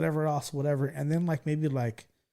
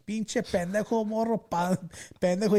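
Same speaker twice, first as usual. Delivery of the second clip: higher pitched, a mild mock of a furious driver; angry Spanish yelling; 6 semitones higher than usual. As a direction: imitate the truck driver cursing you out in Spanish. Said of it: "He's screaming at me in Spanish".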